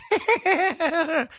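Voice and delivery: high, uncomfortable mock giggle; mock an uneasy forced laugh; imitated nervous laugh. Host imitating anchor getting wrecked, high uncomfortable laugh. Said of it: "He's like".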